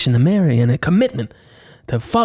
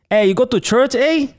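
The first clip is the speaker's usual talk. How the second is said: mock Canadian accent; 8 semitones higher than usual. Host redoing the line in a hammed-up Canadian accent. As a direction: play up the Canadian eh. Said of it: "is that better".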